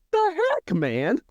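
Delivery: high-pitched, incredulous outburst; mock shock. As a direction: shoot way up in comic disbelief. Acted shocked reaction to Obito's reveal.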